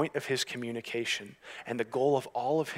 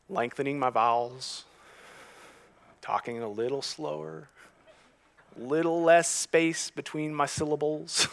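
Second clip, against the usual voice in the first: southern accent; 4 semitones higher than usual. Shown as a demonstration. Preacher showing his quirk to the congregation, drifting into a southern accent. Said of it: "people who have a southern accent".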